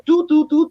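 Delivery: high steady "do, do, do" like elevator beeps; sing-song beeps, pressing every button; button-pushing noises. Retold or imitated.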